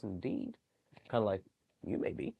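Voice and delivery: plain reading voice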